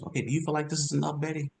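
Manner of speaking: gentle question. Shown as a demonstration. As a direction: ask it softly, like you care. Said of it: "we come in with people skills".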